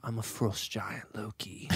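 slow, low, very soft villain; low quiet impression; Loki. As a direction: draw it out low and hushed. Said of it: "Loki is more like this".

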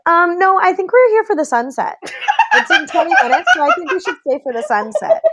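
high-pitched imitated polite firmness; matter-of-fact refusal. Friend refuses wife's push to leave, retold matter-of-fact. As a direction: hold your ground simply, amused in the retelling. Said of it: "It was just so matter of fact".

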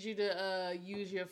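usual speaking voice

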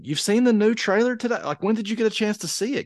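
high-pitched retold disbelief; incredulous question. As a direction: squeak it out in mock astonishment. Retelling his surprised reply to son.

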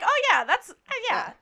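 high, fumbling, caught-out "oh yeah"; awkward agreement. Retold or imitated. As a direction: act sheepish, caught out. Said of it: "I was like".

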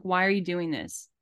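ordinary talk

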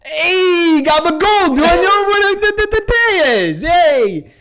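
high, loud New York guy calling from his car; brash New Yorker asking. Acted. Man at a Mexican light calls to locals, brash New Yorker. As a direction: holler it out the car window, East Coast style. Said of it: "If I'm in Mexico and I pull up".

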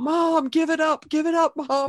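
a hint of a son nudging mom to give it up; mild mock son pleading; son's teasing voice. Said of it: "He's like".

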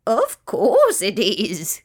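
slow, wobbling bird cackle on the words; amused kookaburra. A narrator's reading. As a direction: draw it out with a chuckling warble. Kookaburra laughing at Rat.

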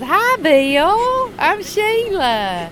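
high, loud, drawn-out sweet greeting; bubbly Sheila. Retold or imitated. Sheila greeting the engineer, high and syrupy sweet. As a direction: sing it out high and sugary. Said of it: "And she goes".

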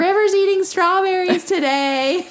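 high, slow sing-song mock voice; mock family voice. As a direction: mimic a bored relative.

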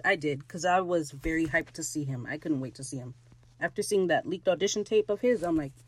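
ordinary delivery

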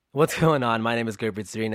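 normal talking voice

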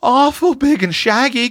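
put-on high, trembling little girl; Roxy describing the bear, quavering. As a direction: quaver it out while clinging to her sister. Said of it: "quavered Roxy".

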